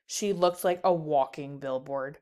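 usual speaking voice

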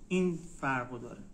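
normal talking voice

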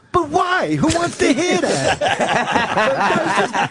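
high, slow, drawn-out mock Dice. Retelling Dice brushing off the impression, slow mock whine. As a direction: stretch it out high like a puzzled comic. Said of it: "And Dice goes".

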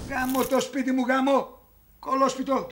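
grumbled cursing. Acted. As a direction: drop the voice and curse the house to yourself. Cursing aside after the shouting.

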